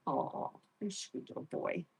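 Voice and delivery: whispered soft coo; tender whisper. Real, not acted. Owner soothing her guinea pig in a tender whisper. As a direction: breathe it to the little pet.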